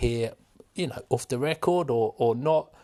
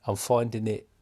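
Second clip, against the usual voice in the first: ordinary talk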